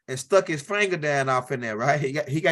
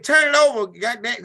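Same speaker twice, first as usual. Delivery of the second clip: impatient yell; 5 semitones higher, 6 dB louder than usual. Retold or imitated. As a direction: bark the order louder and higher. Jed yelling at little man.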